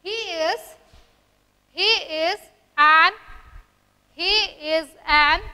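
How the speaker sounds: slow, loud dictation in separated chunks. Shown as a demonstration. Teacher dictating an example to students, slow loud dictation. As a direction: stretch each word at writing pace.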